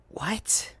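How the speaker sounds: a tired, bewildered whisper; confused whisper. Acted. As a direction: whisper it, dazed and worn out. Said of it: "looking confused and tired".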